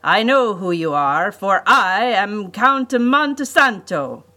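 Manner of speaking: loud, pompous self-announcement, slowing and rising on the name; grandiose villain. A narrator's reading. Count announces himself to the captive children, grandiose and loud. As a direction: proclaim it like a stage villain. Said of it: "he cried".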